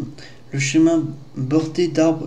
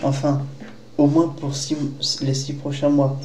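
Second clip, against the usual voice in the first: ordinary delivery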